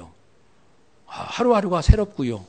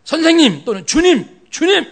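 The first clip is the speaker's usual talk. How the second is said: high, loud alarmed calling out to the Lord; rough protest; 8 semitones higher, 6 dB louder than usual. Retold or imitated. Peter calling out to Jesus in loud protest. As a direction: cry out to stop him, imitating Peter. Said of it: "항변하며 아주 거칠게 항의를 한 거예요".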